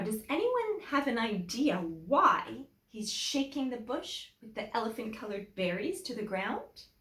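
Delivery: ordinary delivery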